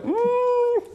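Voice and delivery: very high, held mock hum; uneasy hum. Preacher mimics listeners hearing that was the warm-up, high hum. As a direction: squeeze out a high doubtful mm.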